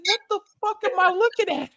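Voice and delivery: high, fast incredulous squeal; baffled disbelief. Retold or imitated. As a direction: blurt it out, utterly baffled. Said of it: "I was like".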